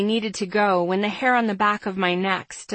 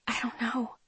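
very soft, hushed reply; barely voiced admission; whispered back; 10 dB quieter than usual. A narrator's reading. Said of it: "I whispered back".

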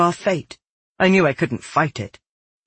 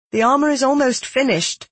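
raised, anxious-to-please pitch; placating; 9 semitones higher than usual. A narrator's reading. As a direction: placate him in a higher voice. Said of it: "I tried to placate him".

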